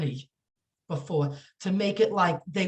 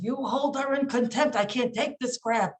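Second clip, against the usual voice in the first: imitating a furious lawyer's outburst, no real shouting; retold angry attorney; 7 semitones higher than usual. Attorney demanding contempt from the judge, mock angry. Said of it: "he was so angry. He says".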